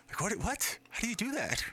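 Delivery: high-pitched incredulous questions; acted amazement. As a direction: marvel at it in a high, playful voice. Acts amazement at dance moves.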